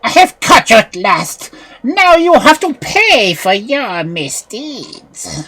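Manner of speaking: high, loud witch threatening slowly; scolding witch. A narrator's reading. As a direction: gloat over the caught thief. Witch scolding caught child.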